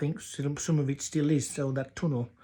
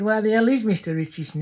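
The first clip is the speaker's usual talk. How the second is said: exasperated wife; 6 semitones higher, 7 dB louder than usual. Acted. Farmer's wife exasperated, snapping.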